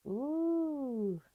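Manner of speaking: a high, pleased ooh; delighted ooh. Real, not acted. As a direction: coo at the pretty colour. Admiring her own paint stroke.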